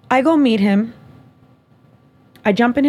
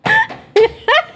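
high laugh. Real, not acted. Reacting to his Instagram post.

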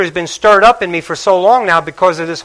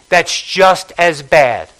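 slow emphatic repeat; 3 semitones lower, much slower than usual. Acted. Preacher repeats his point slowly.

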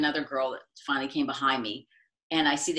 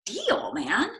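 very high mock-indignant squeak; high, offended mock outrage; incredulous. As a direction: squeak out disbelief at the rude guy. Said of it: "I'm like going".

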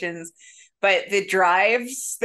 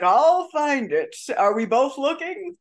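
imitating dad; 6 semitones higher than usual. Dad voiced in Menards story.

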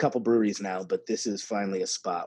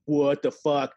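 loud disbelief; 5 semitones higher, 7 dB louder than usual. Retold or imitated. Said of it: "I was like".

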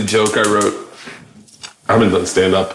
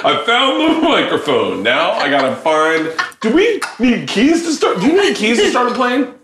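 high, laughing mock pilot on the intercom; airline captain announcement; 9 semitones higher than usual. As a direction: play a rusty captain on the PA. Pilot addressing passengers.

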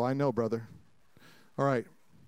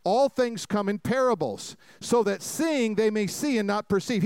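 raised, measured preaching read; scripture reading; 5 semitones higher, 4 dB louder than usual. Acted. Preacher to congregation, reading the parable aloud. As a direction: lift the verse up to the congregation.

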